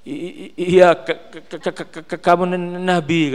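stuttering in mock fright; a frightened worker, held at knifepoint, stammering his answer; mock scared stammer. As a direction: stammer out the yes like a terrified gardener.

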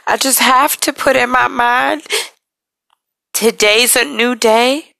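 holding back tears while talking; real emotion, pitch climbing with strain; emotional. Real, not acted. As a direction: voice lifting, close to tears, rising high. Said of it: "forgive me for getting a little emotional".

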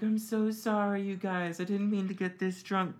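high, whiny mock-drunk sorry; drunk apology. Drunk [name] apologizing to friends in high whiny voice. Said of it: "And I'm like".

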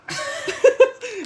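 high, bursting laugh. Real, not acted. Cracking up after the pop-star impression, high laugh. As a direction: crack up at your own joke.

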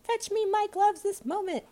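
very high calling voice; rabbit calling. A narrator's reading. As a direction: call out high, as the bossy rabbit. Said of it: "said the voice".

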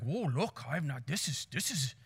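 put-on soft, low South African accent; understated safari guide with a South African accent. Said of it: "the South African guy is like".

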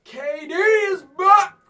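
loud, high, slow exclamation; thrilled fan cheering; excited cheer. Real, not acted. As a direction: celebrate the news. Excited about returning player.

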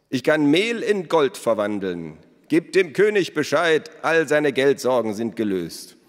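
loud, high grand announcement; showman. A narrator's reading. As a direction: present it like a stage magician. Elos mocks with the gold. (Elos verkündet laut und hoch wie ein Bühnenzauberer)

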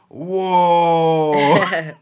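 loud, high startled whoa; astonished whoa. Retold or imitated. Retelling a friend's shock at the border, loud whoa. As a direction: gasp it out in shock.

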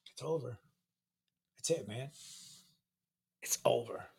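quiet and final; solemn. Retold or imitated. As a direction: close it out like a sponsor ending a ritual. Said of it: "and then he goes".

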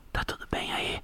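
whispered right in your ear; breathy ASMR whisper close to the mic. Shown as a demonstration. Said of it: "A S M R no seu ouvidinho".

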